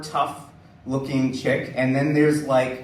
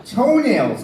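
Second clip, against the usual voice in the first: loud, higher one-word command; the instructor announcing the rule, louder; blunt loud order; 9 semitones higher, 9 dB louder than usual. Retold or imitated. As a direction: bark the word out flatly. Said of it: "And she's like".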